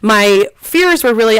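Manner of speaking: normal talking voice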